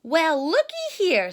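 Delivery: loud, high, hammed-up Papa Bear; big cheerful bear dad. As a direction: boom it out like a jolly dad bear. Papa Bear voiced in read story.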